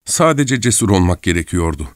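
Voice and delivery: ordinary delivery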